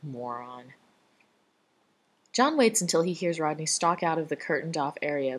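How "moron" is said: much softer muttered insult; under the breath. A narrator's reading. Rodney muttering on his way out, under his breath. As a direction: mutter it aside. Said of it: "under-the-breath addition".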